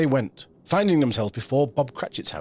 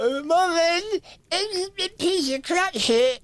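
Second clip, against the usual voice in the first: put-on much higher, slow, dim-witted boy; dopey Peter voice; much slower than usual. Host mocks Peter Cratchit with a high, slow, dim voice. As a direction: play Peter as a slow, simple lad.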